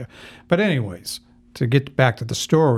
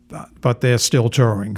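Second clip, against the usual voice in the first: ordinary talk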